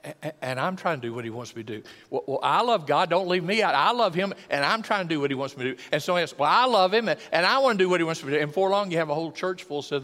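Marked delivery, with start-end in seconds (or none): 2.1-5.8 louder, higher eager chiming in; eager believer. Acted. Second believer insisting on being included, eager and loud. 6.4-8.4 loud, high and rushing at the end; eager believer. Acted. Another church member adding their testimony, eager and loud.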